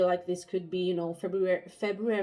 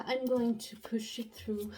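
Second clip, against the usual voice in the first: whispering; 3 semitones higher than usual. Real, not acted. Pregnant, tired, out of breath.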